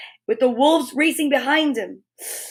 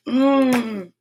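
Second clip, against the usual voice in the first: usual speaking voice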